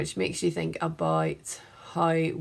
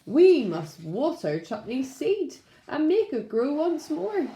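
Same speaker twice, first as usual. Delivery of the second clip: put-on higher, eager kids' voice; children's voices; 8 semitones higher, slower than usual. The boy and girl next door planning to save the seed, higher voice. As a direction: lift it like keen children. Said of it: "the boy and girl next door said".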